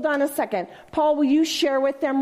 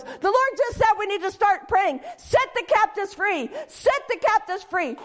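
urgent excited report; 8 semitones higher than usual. Retold or imitated. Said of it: "he runs to me and he goes".

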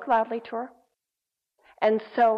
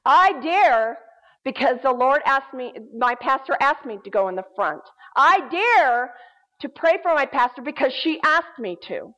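bold, defiant retort, each 'I dare' punched louder; rising strength; 5 dB louder than usual. Retold or imitated. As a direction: stand up to the demon, imitating her firm defiance. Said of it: "A rising of strength rises within her".

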